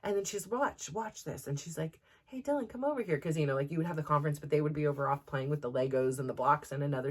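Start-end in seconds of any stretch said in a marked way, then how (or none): 0.5-1.5 teacher. Retold or imitated. Teacher tells mother confidentially. 2.3-3.2 high, sweet calling voice; teacher calling a child. Retold or imitated. Teacher calling Dylan over from the Legos, high sweet voice.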